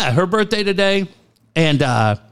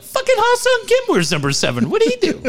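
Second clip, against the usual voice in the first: high hammed-up rant about number seven; high mock rant. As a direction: play it as an outraged caricature, pitched way up. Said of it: "I'm like".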